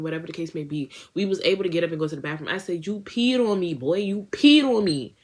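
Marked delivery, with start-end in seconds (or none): 2.9-5.1 accusing. Retold or imitated. Accusing the friend.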